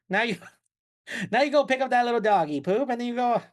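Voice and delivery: put-on high, quick old-movie patter breaking into a laugh; Atlantic talkie accent. Shown as a demonstration. Ordering missy to clean up after the dog, in talkie accent. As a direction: keep the talkie accent going until it cracks. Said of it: "I could do talkie all episode".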